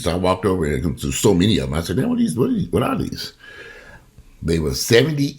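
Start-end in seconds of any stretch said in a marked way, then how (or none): 2.0-3.3 much higher, astonished repeats; amazed. Retold or imitated. Shopper amazed at cheap TVs in Walmart, astonished. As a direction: gasp at the pile like a kid in a toy shop.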